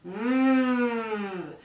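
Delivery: long kazoo-like hum into a straw; buzzing straw hum. Shown as a demonstration. Teacher showing singers the straw exercise, long buzzing hum. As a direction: sustain a gliding buzz through the straw. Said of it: "We're gonna buzz into it".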